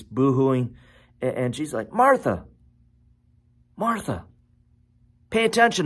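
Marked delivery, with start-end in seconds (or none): 1.9-5.9 high, insistent call with long gaps; acted parental insistence, pitched up; getting her attention. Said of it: "in order to get her attention".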